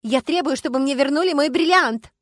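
high, loud, insistent outcry; shouted demand. A narrator's reading. Starkova demanding her diamond back from the police, shouting. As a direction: cry out and insist. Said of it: "закричала Аграфена Дамировна".

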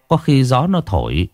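ordinary delivery

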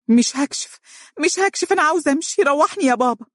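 sudden panicked outcry. Acted. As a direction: cry out in panic, begging to leave. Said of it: "هتفت فجأة". (صرخة فزع مفاجئة بصوت مرتفع جدًا)

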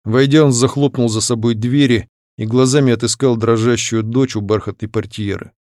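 ordinary delivery

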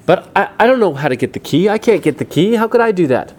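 high, loud, flustered objection; overwhelmed and arguing back; panicked protest. Acted. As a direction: plead helplessly, pitch climbing. Pedro protests he can't get key.

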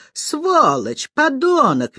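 high-pitched acted squeal of rage; screeching abuse. A narrator's reading. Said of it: "визжала Аня".

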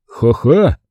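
a flat, spoken ha-ha; deadpan ha-ha. A narrator's reading. Butler mocking his boss with a dry ha-ha. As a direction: say the laugh without laughing. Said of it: "произнёс".

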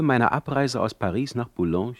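ordinary delivery

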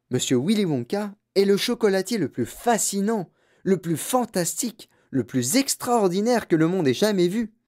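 put-on marvelling old storyteller, lifted pitch; enthusiastic grandfather. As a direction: relish each superlative. Reader voices enthusiastic grandfather.

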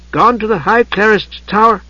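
high voice deciphering a note; reading aloud. A narrator's reading. Tas reading smudged note to Usha, reading aloud. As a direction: read it out piece by piece.

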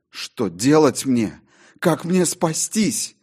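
high, slow, desperate questions; desperate plea. Acted. John pleads aloud in the theatre, desperate. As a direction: beg for a way out.

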